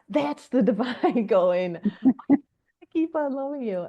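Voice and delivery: high, slow, laughing through the words; laughing awe. Retold or imitated. Telling how the divine spoke through the song, high, slow, laughing. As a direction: marvel at it between laughs. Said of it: "And I'm like".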